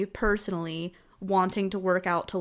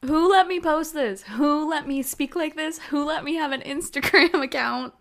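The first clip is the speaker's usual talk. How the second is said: embarrassed outcry; 6 semitones higher, 3 dB louder than usual. Real, not acted. Cringing at old posts.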